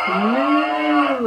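drawn-out moo; mooing cow. Acted. Parent voicing the cow again for her child, mooing. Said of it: "And the cow said".

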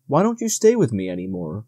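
ordinary delivery

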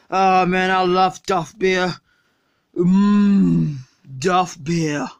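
put-on low slurred drunk voice; sluggish, drunk and lazy; Homer Simpson.

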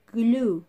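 Glue said loudly and over-clearly; slow clear model word. Shown as a demonstration. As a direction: punch out Glue for the class to repeat. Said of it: "Repeat after me".

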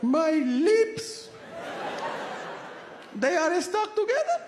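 put-on high, dazed old-mother voice, giggly; stoned mother. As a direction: play the high mother, way up in pitch. High-pressure mother voiced in story.

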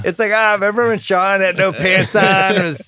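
high, loud laughing recap; laughing retelling. Retold or imitated. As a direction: tell it cracking up. Mimicking retelling about Connery.

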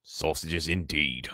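plain reading voice

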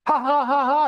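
a hammed-up jeering ha ha ha; mock cackle of scorn; mocking laugh. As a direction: laugh it out like a soldier taunting a prisoner. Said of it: "You can just hear him laughing, mocking him".

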